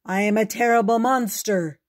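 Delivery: loud, hammed-up monster voice; pretend monster. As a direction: boom it out like a kid playing monster. Boy plays monster in bag.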